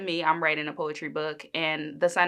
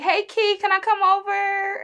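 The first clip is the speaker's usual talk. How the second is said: put-on high, loud little-girl voice; imitating little cousin; 5 dB louder than usual. Little cousin asking to visit, high-pitched mimicked child voice. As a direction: mimic an eager child on a video call. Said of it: "she FaceTime me all the time".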